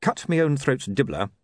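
usual speaking voice

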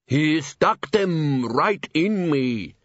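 very slow, aggrieved vampire; drawn-out complaining vampire; whiny vampire. A narrator's reading.

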